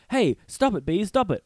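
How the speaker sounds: acted-out high, loud protest; mock scolding shout. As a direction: shoo them off loudly. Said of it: "the Doctor Doolittle's like".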